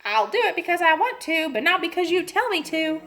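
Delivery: a defiant parrot talking back; squawking parrot. A narrator's reading. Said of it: "Penelope squawked".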